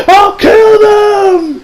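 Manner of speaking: high shout, hugely drawn out; hammed-up villain scream; mock death threat. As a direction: howl it like a ranting anime villain. Acted villain threat ending the rant.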